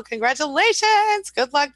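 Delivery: high, loud, drawn-out cheer; sing-song celebratory whoop, stretched out; excited congratulations. Real, not acted. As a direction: celebrate their good news at high pitch. Cheering a viewer's job interview news.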